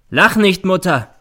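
high, loud angry order; sharp command. A narrator's reading. Son rebuking his laughing mother, imperious. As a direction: lash out to stop the laughter. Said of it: "herrscht sie der Sohn an".

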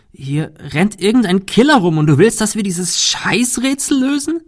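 hoch hinaufgetriebene, empörte Frage; verzweifelt, latent wütend. A narrator's reading. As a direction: halte es ihm verzweifelt und gereizt vor. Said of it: "Shirley klang verzweifelt und latent wütend". (verzweifelt und latent wütend)

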